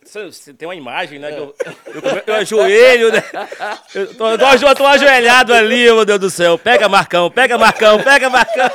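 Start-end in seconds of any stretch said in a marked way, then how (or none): 1.9-8.7 loud excited retelling. Retold or imitated. Retelling tense penalty, praying loudly.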